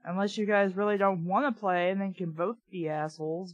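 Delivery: ordinary talk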